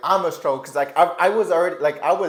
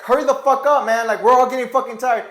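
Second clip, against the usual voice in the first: higher, louder exasperated shout; fed-up yell; 7 semitones higher, 6 dB louder than usual. Retold or imitated. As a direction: yell at him to hurry, sick of waiting. Exasperated group yelling at recruit.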